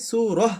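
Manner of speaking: "সুরহ" with long oo and a breath of h at the end; stop on h. Shown as a demonstration. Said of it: "তাকে হা পড়ব".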